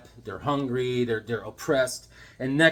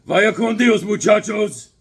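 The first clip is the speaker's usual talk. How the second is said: loud, high mock Spanish farewell; a hammed-up cowboy sendoff before the blasting; tough hero; 7 semitones higher, 10 dB louder than usual. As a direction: ham it up like a western gunslinger. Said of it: "he's like".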